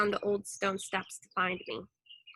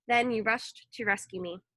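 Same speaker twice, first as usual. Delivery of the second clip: plain reading voice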